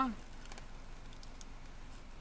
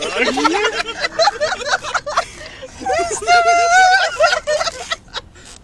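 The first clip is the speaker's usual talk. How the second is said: big burst of real laughter; howling laugh at the drive-thru; loud laughter; 9 dB louder than usual. Real, not acted. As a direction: crack up hard, can't stop. Cracking up after drive-thru order.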